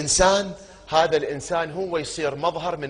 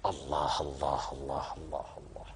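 murmured invocation; 11 semitones lower, 12 dB quieter than usual. Real, not acted. Preacher sighs God's name.

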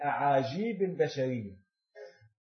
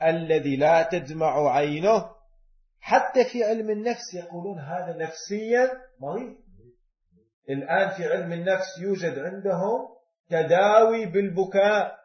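ordinary talk, nothing marked

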